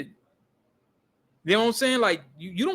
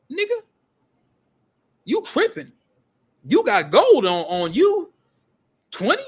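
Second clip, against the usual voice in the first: high, incredulous scolding with swoops in pitch; mock outrage leaping into a high voice; imitated pissed-off disbelief; 7 semitones higher, 4 dB louder than usual. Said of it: "She was pissed".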